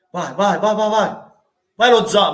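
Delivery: ordinary talk, nothing marked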